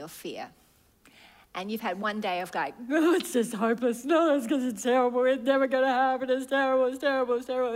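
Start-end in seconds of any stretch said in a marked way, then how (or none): 2.9-7.7 fast, louder, spiraling into it's terrible; a worrier convinced all is hopeless; panicked despair. Acted. Acting an anxious despairing believer.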